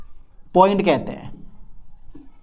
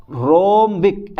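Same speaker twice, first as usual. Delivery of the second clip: slow drill; much slower than usual. Shown as a demonstration. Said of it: "drilling the term".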